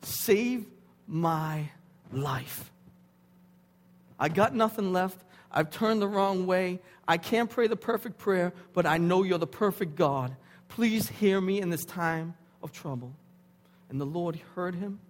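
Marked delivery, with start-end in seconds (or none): 0.0-2.7 halting plea, word by word; retold cry for help. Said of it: "He cried out and said". 4.2-13.1 loud, raised imploring plea; crying out in prayer. Retold or imitated. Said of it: "He cried out and said".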